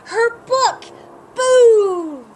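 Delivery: loud, high panicked cry; frantic shout; alarmed cry. Acted. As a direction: cry out in alarm over the book. Storm ruins library book.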